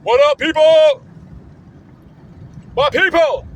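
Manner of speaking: high, loud hyped-up hello; loud hype greeting. Real, not acted. Vlogger hyping up his viewers, loud and high. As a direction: shout out to the viewers.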